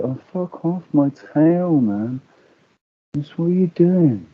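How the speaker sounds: voicing the dog. Speaking for his dog Alfie pestered by the cat, mock-annoyed. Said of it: "Alfie's like".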